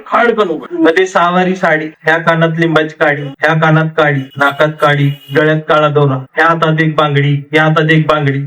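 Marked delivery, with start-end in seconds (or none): none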